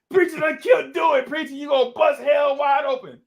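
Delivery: high, loud shouted joking alarm; mock alarmed shout. Friends joke with Preacher, loud mock-panicked shout. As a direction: yell it like he really died. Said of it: "we joking with Preacher".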